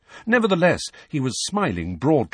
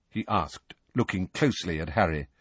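ordinary delivery

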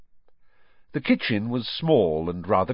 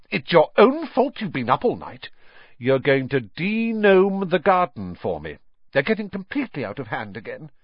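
higher, brisk motherly telling-off; snappish scolding; 6 semitones higher, 3 dB louder than usual. A narrator's reading. Mother ordering her son to de-gnome the garden, snapping. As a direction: lay down the punishment crossly. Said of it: "snapped Missus Weasley".

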